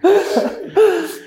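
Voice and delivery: laugh. Real, not acted. Laughing at end of story.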